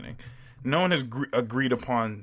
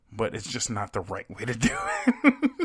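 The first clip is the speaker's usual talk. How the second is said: ordinary talk, nothing marked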